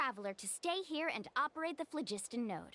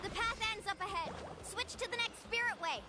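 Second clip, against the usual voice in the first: high shouted directions; urgent calls to the Traveler; calling out; 7 semitones higher than usual. Acted. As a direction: keep calling the route to your partner. Calling directions mid-action.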